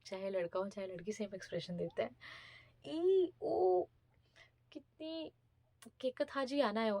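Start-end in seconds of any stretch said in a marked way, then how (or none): 2.9-6.9 a high, loud "ई, ऊ", hammed up; exaggerated disgust. Mocking how the interviewer recoiled at India, hammed-up disgust. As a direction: overplay the squeamish reaction. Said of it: "ऐसे करके उन्होंने मुझे बोला".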